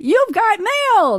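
high, drawn-out title announcement; announcer flourish. Real, not acted. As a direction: sing out the film title slowly. Announces the guessed film title.

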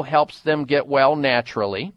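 ordinary delivery

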